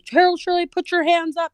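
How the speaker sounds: loud, high commanding yell; retold police yell; police shout. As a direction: bark the arrest order like a cop.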